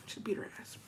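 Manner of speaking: unvoiced gossipy whisper. Real, not acted. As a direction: drop to a secret whisper. Gossip aside.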